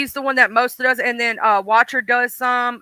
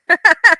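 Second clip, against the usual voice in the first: short, loud, high laugh; 7 semitones higher, 8 dB louder than usual. Real, not acted. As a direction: burst out laughing after the chant. Laughs after spelling chant.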